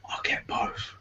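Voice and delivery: soft sly whisper to himself; whispered thought. Retold or imitated. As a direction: breathe it like a secret temptation. Thinking aloud, gamer temptation.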